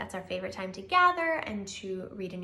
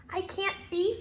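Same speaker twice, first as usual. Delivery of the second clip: tiny mouse; 10 semitones higher than usual. Acted. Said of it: "said Mouse".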